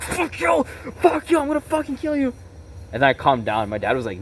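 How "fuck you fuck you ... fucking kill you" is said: furious screaming. Retold or imitated. Teen snapping back at his dad after a smack, screaming. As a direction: scream it like you've finally snapped.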